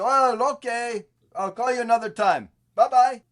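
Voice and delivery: hammed-up clueless goodbye; idiotic caller. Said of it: "if I act totally idiotic and stupid".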